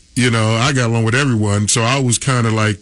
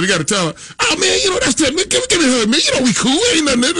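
imitating Jerome; faster than usual. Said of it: "he said".

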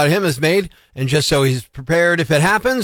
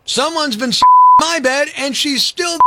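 put-on baby bear exclaiming; 7 semitones higher than usual. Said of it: "exclaimed the baby bear".

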